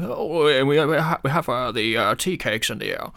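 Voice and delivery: stammering, hesitant English gent; bumbling Englishman. Acted. Showing co-host the cliche accent, bumbling Englishman. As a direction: bumble and stumble like a flustered Englishman. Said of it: "the bumbling Englishman".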